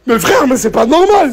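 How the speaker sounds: high-pitched incredulous objection; exasperated protest. Real, not acted. Man objecting to his friend, high exasperated protest. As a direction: protest to your mate, voice shooting up. Heated joking argument over feet.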